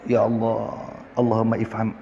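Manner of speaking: low, soft plea to God; quiet prayer. Real, not acted. Preacher asks God for understanding mid-sermon, quiet low prayer. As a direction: drop right down into a private prayer.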